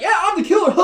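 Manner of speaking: very high, silly put-on voice; mock villain gloating. Killer gloats to his victims, mock villain gloating.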